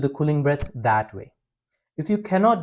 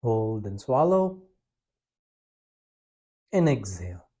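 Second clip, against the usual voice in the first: guided breathing; 3 semitones lower, 7 dB quieter than usual. Acted. Leads a breathing practice.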